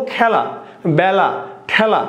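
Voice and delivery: খেলা, বেলা, ঠেলা with wide "æ": khæla, bæla, thæla; open ae vowel. Shown as a demonstration. Teacher giving students example words, open ae vowel. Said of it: "বেশি মেলে বা বেশি প্রসারিত হয়".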